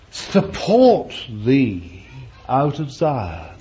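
slow, solemn psalm reading; scripture reading. Acted. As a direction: intone the psalm. Reads psalm aloud.

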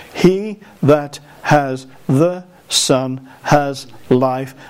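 each word slow, weighed and spaced; slow deliberate reading. Shown as a demonstration. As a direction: read every word slowly, with weight. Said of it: "these are single syllable words for you".

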